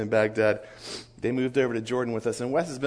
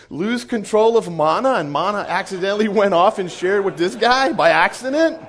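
loud, high, incredulous rant; mock incredulous; 7 semitones higher, 7 dB louder than usual. Leader challenging the missionary's doubt, loud mock incredulity. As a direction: belt out an absurd rhetorical question.

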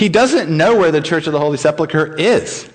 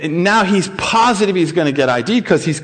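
ordinary delivery

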